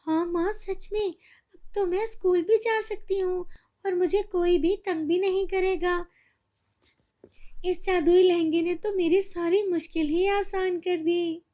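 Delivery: put-on high, happy child voice; girl overjoyed she can go to school; joyful girl. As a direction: relief and delight. Girl rejoices.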